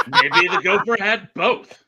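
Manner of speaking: loud laughing quip. Real, not acted. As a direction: say it through a laugh.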